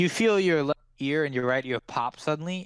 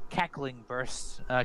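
usual speaking voice

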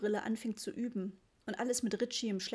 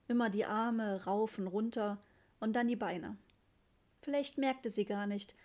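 usual speaking voice

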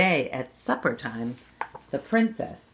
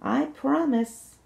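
slow promise, much higher pitch; sing-song promise, said again higher; 9 semitones higher, slower than usual. Acted. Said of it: "she said again".

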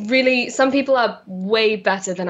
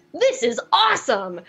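higher, wide-eyed newcomer's delight; starstruck excitement; 4 semitones higher than usual. Retold or imitated. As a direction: re-enact bright-eyed first-day excitement. Acting out her excited younger self.

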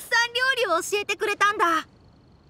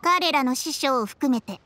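ordinary talk, nothing marked

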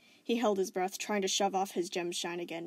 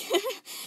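high forced wheeze; wheezing laugh; 13 semitones higher, 5 dB louder than usual. Acted. Spinel forcing cheer, wheezing laugh. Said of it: "She wheezed".

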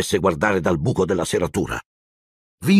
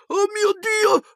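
very high panicked gasp; shrieking dismay; horrified shriek; slower than usual. A narrator's reading. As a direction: scream in disbelief. Wife reads the letter, horrified.

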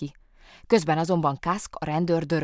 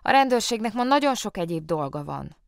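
self-important, very busy policeman; busy official tone; 6 semitones higher, 5 dB louder than usual. A narrator's reading. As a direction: sound swamped with work. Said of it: "olyan hangon, mintha valóban nagyon sok dolga volna".

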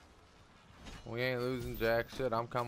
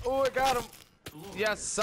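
much higher, louder triumphant cheer; excited cheer; 12 semitones higher, 5 dB louder than usual. Real, not acted. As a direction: whoop over the kill. Celebrating a kill.

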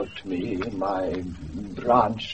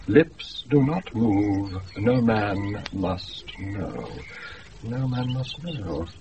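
slow wondering read; 4 semitones lower, slower than usual. Acted. Reading mysterious letter slowly.

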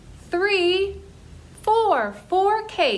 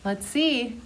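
ordinary delivery

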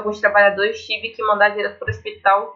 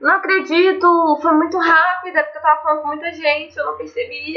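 frustrated cry, pitch jumping higher; raging gamer; 6 semitones higher than usual. Real, not acted. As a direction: loses it over dying in the game. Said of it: "Me emocionei".

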